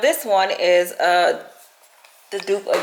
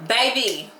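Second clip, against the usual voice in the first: usual speaking voice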